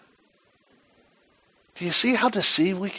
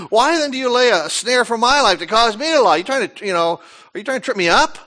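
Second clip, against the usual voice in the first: a raised, accusing challenge, louder and higher; indignant medium; 6 semitones higher, 6 dB louder than usual. Acted. The medium confronts disguised Saul with a raised, accusing voice. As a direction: throw the accusation back at the king.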